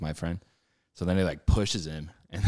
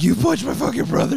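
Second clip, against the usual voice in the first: very high, loud frantic yell; mock spazzing out; 7 dB louder than usual. Brother confronting the puncher, mock freak-out. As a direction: imitate someone flipping out, shrieking the accusation. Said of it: "he just spazzes".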